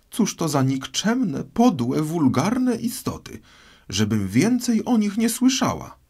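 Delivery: high, indignant tirade; squeaky-high scorn and disgust; outraged mouse. A narrator's reading. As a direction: rant shrilly as an offended mouse. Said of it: "krzyknęła mysz, trzęsąc się".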